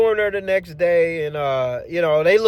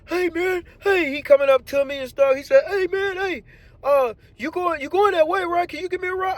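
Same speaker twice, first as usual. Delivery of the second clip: much higher, excited thankful buddy; squeaky grateful coworker; coworker's high voice; 11 semitones higher than usual. Retold or imitated. Coworker thanking the driver, much higher voice.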